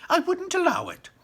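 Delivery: very high, loud refusal; high loud Wonka; Wonka refusing. Acted. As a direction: refuse flatly.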